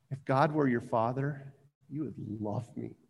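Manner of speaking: soft and low, fading almost away; hushed repeat. Acted. Preacher has listeners think about the verse, hushed. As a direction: let the verse sink in quietly.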